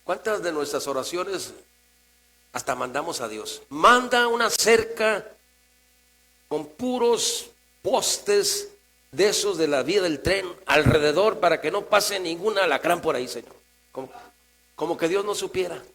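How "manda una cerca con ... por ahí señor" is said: mock a bossy prayer ordering God around; imperious mock prayer, punching out the first words; demanding decree prayer. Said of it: "los que decretan".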